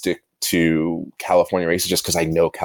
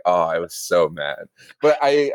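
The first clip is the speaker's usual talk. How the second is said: ordinary talk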